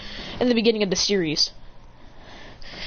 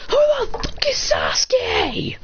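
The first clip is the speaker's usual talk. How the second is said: high, stretched-out exasperated question; baffled outburst; much slower than usual. Acted. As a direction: burst out in acted bafflement. Character baffled by unknown name.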